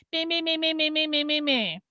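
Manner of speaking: mocking the commenters' chatter; mocking meh. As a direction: brush them off with a mocking bleat.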